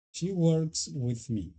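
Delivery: slow, evenly spaced "She works with me"; careful teacher demo; slow model sentence. Shown as a demonstration. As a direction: lay each word out for learners. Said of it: "Ella trabaja conmigo".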